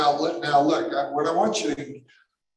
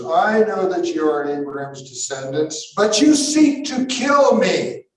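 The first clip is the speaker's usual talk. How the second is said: forceful scripture quote, rising to an accusation; Jesus; 2 semitones higher, 4 dB louder than usual. Acted. Jesus confronting Abraham's descendants, accusing. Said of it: "this is Jesus".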